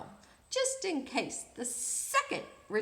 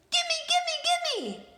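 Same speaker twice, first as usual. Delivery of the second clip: high, loud screechy parrot squawk; 6 dB louder, slower than usual. Acted. Reading a story, the rude parrot squawks at the prince. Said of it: "said the parrot".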